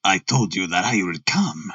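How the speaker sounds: put-on low, harsh German-accented voice; deep voice with a German accent. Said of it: "harsh voice and a strongly marked German accent".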